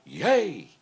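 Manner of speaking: high mock cheer from the crowd; half-hearted chorus of cheers; cooks cheering. Kitchen staff greet the new singer with high cheers. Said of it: "The kitchen goes like this".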